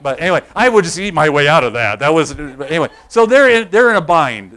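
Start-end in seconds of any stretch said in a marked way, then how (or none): 3.1-4.5 loud restart. Real, not acted. Laughing aside then loud restart.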